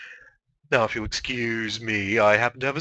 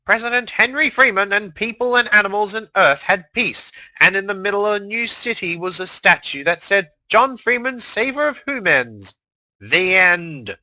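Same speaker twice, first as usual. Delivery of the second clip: higher, louder storyteller narration; dramatic story reading; 7 semitones higher, 5 dB louder than usual. Acted. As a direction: read it like an epic saga. Reads fan fiction dramatically.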